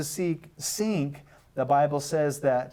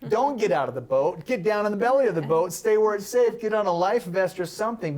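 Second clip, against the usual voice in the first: higher, louder, rushed mock panic; faster, higher and louder mock alarm; mock panicked crew; 6 semitones higher, 3 dB louder than usual. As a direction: ham up a frightened sailor begging him to stay put. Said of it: "Most of us would say".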